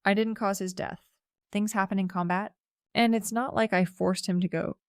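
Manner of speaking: ordinary delivery, nothing marked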